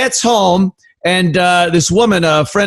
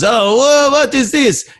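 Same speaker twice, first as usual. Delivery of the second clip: high, wondering foreigner's question; French tourist; 8 semitones higher than usual. Acted. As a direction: ask in a high, puzzled tourist voice. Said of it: "the French guy goes".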